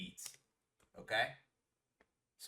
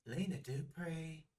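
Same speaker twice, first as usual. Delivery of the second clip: slow, higher-pitched drawling impression; Lena Dupree; 6 semitones higher, slower than usual. Showing friend how the hostess talks, slow drawled impression. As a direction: stretch the name out in a put-on Southern voice. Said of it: "That's how she took".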